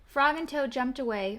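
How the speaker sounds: usual speaking voice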